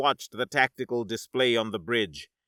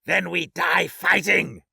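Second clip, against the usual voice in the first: loud, slow, raised-pitch war cry; roaring; 5 semitones higher, 3 dB louder than usual. Acted. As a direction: roar it in defiance. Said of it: "Zorath roared".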